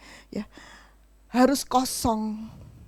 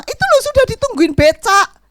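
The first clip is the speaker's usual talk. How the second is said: a higher, louder mom voice; mother calling; 9 semitones higher, 7 dB louder than usual. Retold or imitated. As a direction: hurry your child along. Ibu memanggil anak. (ibu menyuruh cepat, suara tinggi)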